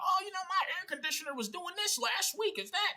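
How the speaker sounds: imitated friendly small talk, very high voice; chatty neighbor. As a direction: put on a high, eager questioning voice. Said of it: "he probably would have been asking me".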